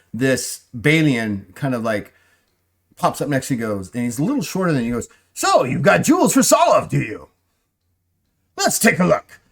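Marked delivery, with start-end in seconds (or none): 5.3-9.4 high, loud, pouncing merchant voice; snatch at the deal with glee; eager shopkeeper. Acted.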